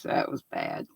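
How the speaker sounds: breathy whisper, half to herself. Real, not acted. Embarrassed host whispering to chat about her joke.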